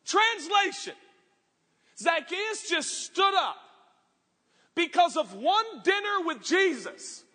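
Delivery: full-force preaching. Acted. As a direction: drive it home to the church.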